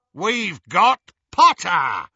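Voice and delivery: loud, slow, high werewolf roar; triumphant roar. A narrator's reading. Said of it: "Greyback roared triumphantly".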